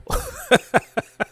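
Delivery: real high laugh breaking through; burst of laughter. Real, not acted. Host can't keep a straight face, bursting out laughing. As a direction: crack up laughing mid-rant. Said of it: "can't even say this stuff with a straight face".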